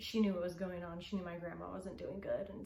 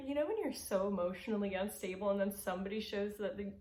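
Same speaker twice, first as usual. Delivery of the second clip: ordinary talk, nothing marked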